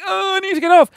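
high mock-panicked cry to get off; pleading from under the water, half joking; panicked plea. Stuck under the pool water, pleading in mock panic. Retelling being trapped underwater.

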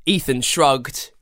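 usual speaking voice

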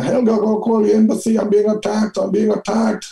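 high-pitched, panicked fake Swedish cry for help; mock Swedish accent. Cornered fugitive posing as a Swedish backpacker, mock Swedish accent. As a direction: squeal it in a hammed-up Swedish accent. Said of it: "put on my best Swedish accent".

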